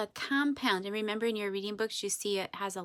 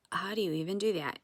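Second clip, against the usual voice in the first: ordinary talk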